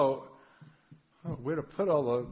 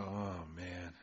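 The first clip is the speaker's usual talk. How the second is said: morning groan; 6 semitones lower than usual. Retold or imitated. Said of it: "look at the sink and go".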